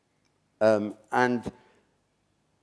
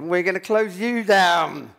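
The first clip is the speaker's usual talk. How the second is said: slow, louder, higher mock threat from an old lady; threatening old lady; 7 semitones higher, 6 dB louder, slower than usual. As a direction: lift the stick and warn him, slow and loud. Old lady threatens with walking stick.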